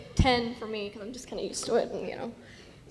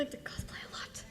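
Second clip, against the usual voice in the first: softer conspiratorial whisper aside; 18 dB quieter than usual. Real, not acted. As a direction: confess it under your breath. Ironic aside after "couldn't tell.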